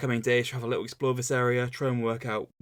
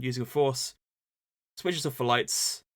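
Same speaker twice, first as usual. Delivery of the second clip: normal talking voice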